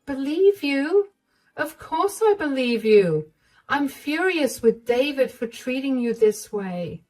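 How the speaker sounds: of course I believe you; indignant mother-in-law. Acted.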